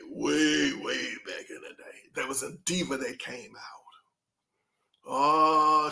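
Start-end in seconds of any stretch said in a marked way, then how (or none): none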